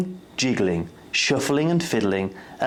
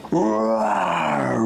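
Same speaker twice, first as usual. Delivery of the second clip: growled roar word; playful storybook roar; tiger roar; 3 semitones lower than usual. A narrator's reading. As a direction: roar like a big cat. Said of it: "roared the tiger".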